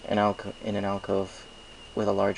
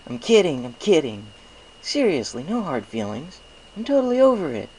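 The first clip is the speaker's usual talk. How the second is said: high, louder joking brush-off; smirking reassurance; 7 semitones higher, 6 dB louder than usual. Acted. Spike waves off the stallion's apology with a smirk, high and joking. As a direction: wave it off with a smirk. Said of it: "waved me off with a smirk".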